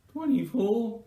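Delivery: high-pitched silly imitation voice; reply in a mock high, odd voice; exaggerated high impression of a mate; Wayne. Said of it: "What's wrong with your voice?".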